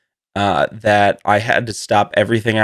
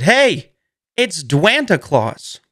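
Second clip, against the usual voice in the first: a hint of a cheery ad host, slow on Dwanta; imitating The Rock; 5 semitones higher, slower than usual. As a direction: lightly greet like a jolly holiday mascot. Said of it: "and he said".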